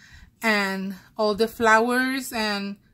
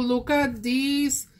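ordinary talk, nothing marked

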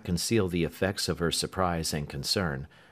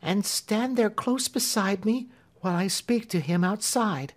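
put-on a timid girl set on her purpose; resolute Marian; 13 semitones higher than usual. A narrator's reading. Marian asking Clemency to open the door, high and resolute.

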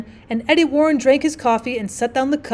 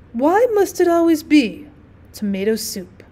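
poetry recital; 4 semitones higher than usual. A narrator's reading. Guest showing hostess an incredibly beautiful line, intoned recital. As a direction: intone it like a precious verse.

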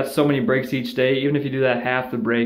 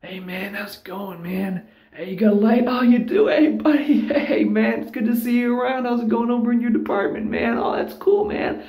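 much higher, chummy mock voice with a laugh; friendly smoker buddy; 12 semitones higher than usual. A smoker greeting a coworker for a light, high and chummy. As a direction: ham up the overly friendly guy at the smoke circle. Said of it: "It's like".

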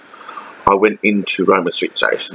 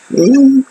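a high, loud, swooning "mm"; a real gushing hum of delight; dreamy hum; 7 dB louder than usual. Real, not acted. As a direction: let out a lovesick hum, way up high. Dreamy about romantic trip.

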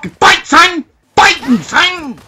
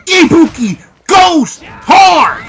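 slow, drawn-out hype shout, louder; 4 semitones higher, 6 dB louder, much slower than usual. Real, not acted. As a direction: bellow the catchphrase slowly. Hyped catchphrase during fight.

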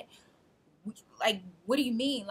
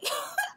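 laughs out high at herself; high burst of laughter. Real, not acted. Laughs at her own retort.

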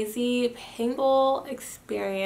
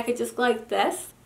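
talking with finger on teeth; finger in mouth. Real, not acted. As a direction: fingers pressing front tooth. Seen 0: Fingertips held against front teeth and lips, 0.6–1.6 s, mid-sentence.

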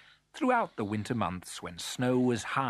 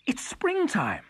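high, voiced cry of realisation; excited exclamation; 14 semitones higher, 4 dB louder than usual. A narrator's reading. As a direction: exclaim it with rising joy. Cuckoo realises spring has come.

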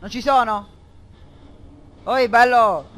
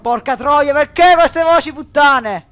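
much higher and louder, slow, outraged; furious shout; 8 semitones higher, 15 dB louder, slower than usual. Real, not acted. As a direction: yell at the creepy voices. Scared by voices in game.

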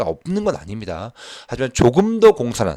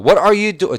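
first take; 10 semitones higher, 7 dB louder, much slower than usual. Shown as a demonstration. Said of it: "두 번째 읽어볼게요".

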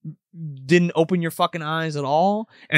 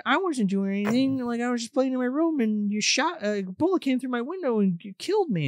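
higher, lightly voiced child; imitated little girl; 7 semitones higher, 4 dB quieter than usual. Little girl ghost confronts her killer, higher child imitation. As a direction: plead like a little girl, pitched up. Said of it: "Then this little girl shows up".

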